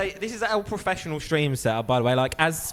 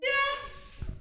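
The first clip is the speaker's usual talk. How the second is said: excited cheer; 6 dB quieter than usual. Real, not acted. Cheering a result.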